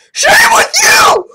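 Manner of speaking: very high, loud outraged yell; furious shriek. Real, not acted. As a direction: shriek at the screen. Angry at game.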